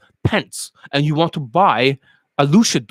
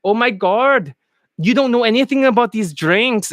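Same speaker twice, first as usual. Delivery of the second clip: higher-pitched Indian accent attempting posh; mock Indian accent; 6 semitones higher than usual. Indian student disagreeing in London, hammed-up Indian accent. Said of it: "But you're Indian, and you're like".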